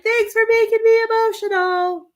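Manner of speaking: high, loud, teasing mock complaint; mock grumble. Reader teasing the spirit for making her cry, high mock complaint. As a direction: pretend to scold them for the tears. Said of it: "I was like".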